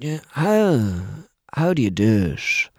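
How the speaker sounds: Tommy Tiernan impression. Impressionist retelling Tommy Tiernan asking how he does it, in Tommy's voice. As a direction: do Tommy marvelling at the likeness. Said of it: "I met Tommy Tiernan".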